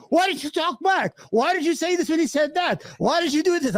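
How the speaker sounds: high-pitched, scolding questions; nagging family. Retold or imitated. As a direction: pester him like an upset relative. Family scolding him after debate.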